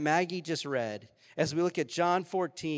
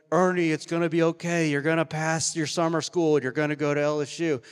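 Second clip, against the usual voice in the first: louder, booming reassurance from above; God from the clouds; 3 semitones higher, 8 dB louder than usual. Pretend. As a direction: announce it like a voice from heaven. Imagined God speaking from the clouds.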